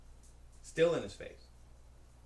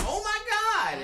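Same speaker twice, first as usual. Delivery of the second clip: loud, very high cry of disbelief; high exasperated outburst; exasperated shout; 11 dB louder, slower than usual. Retold or imitated. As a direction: blurt it out at the top of your voice. Exasperated reaction, acted loudly.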